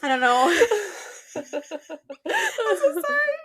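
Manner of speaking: slow apology through real laughter; laughing apology. Real, not acted. Laughs at being out of breath.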